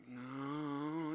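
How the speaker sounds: soft, drawn-out mock refusal; long, low-key singsong no; kids refusing. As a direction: imitate children quietly holding out. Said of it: "And they're all like".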